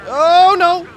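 high loud cry of alarm. Real, not acted. Racer nearly wrecking, crying out in alarm.

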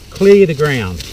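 usual speaking voice

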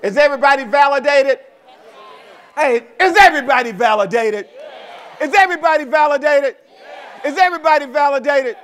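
high, very loud call to the crowd; real full-force audience rouser; rallying shout. Real, not acted. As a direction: shout to fire up the whole room. Speaker rousing the audience, repeated call.

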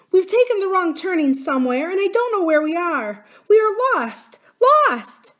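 high, loud, panicked wail; great despairing cry. A narrator's reading. Nurse terrified at losing the way, panicked cry. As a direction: wail it high, breaking on the last word. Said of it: "gave a great cry, and said".

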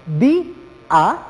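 "di, a" as two separate beats, stress on "di"; syllable split. Shown as a demonstration. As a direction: split "di, a" with a clear gap, leaning on "di". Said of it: "a la hora de emitir la fuerza de voz". ("di, a" separado en dos sílabas, con fuerza en "di")

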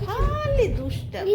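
high, drawn-out mock reproach; playful scolding. Real, not acted. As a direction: stretch it out as an indulgent telling-off. Playfully scolding her child. (আদুরে বকুনি)

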